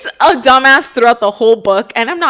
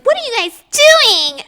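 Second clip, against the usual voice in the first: mock Saris; 12 semitones higher, 3 dB louder, slower than usual. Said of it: "Saris comes in and she's like".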